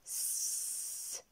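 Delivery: "S" as a long soft sss, no voice; isolated consonant sound. Shown as a demonstration. Teacher sounds out a syllable, consonant "S" held long. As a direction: hold the hiss of "S", not the letter name. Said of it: "donne la syllabe".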